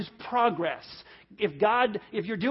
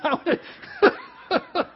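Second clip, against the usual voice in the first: high, broken up with laughter; cracking up mid-sentence; laughing; 8 semitones higher, much slower than usual. Real, not acted. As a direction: talk through helpless laughing. Laughing at his own joke.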